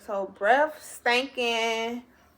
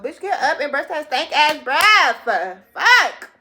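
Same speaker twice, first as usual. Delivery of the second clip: much louder, higher and slow furious yell; angry shout; 5 semitones higher, 10 dB louder, slower than usual. Retold or imitated. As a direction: bark at him to get out of bed. Scolding him about his breath.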